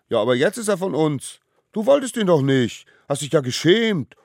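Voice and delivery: loud, high accusing retort; squabbling. Acted. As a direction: argue back like squabbling children. Little ones quarrel over the gift. (laut und vorwurfsvoll zankend)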